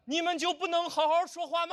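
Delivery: very high mock-outraged cry; hammed-up protest, pitched right up; exasperated outburst. Imitating spectator jumping up at a musical, exasperated high cry. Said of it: "突然就站起来了".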